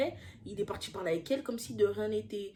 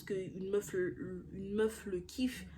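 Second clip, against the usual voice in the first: slow whispered aside; 3 semitones lower, 9 dB quieter, much slower than usual. Real, not acted. Whispered aside about the girl. (aparté chuchoté lentement)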